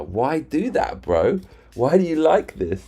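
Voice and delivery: high-pitched, drawn-out why, bro; stretched-out disbelieving question; exasperated disbelief. Real, not acted. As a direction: plead to understand him, baffled. Rants about friend's love of arguing.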